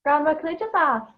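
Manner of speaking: plain reading voice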